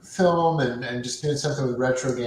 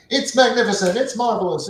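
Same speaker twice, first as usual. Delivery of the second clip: Dan Aykroyd salesman voice; 8 semitones higher than usual. Retold or imitated. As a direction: sell it like a smooth TV pitchman. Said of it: "that was his Dan Aykroyd persona".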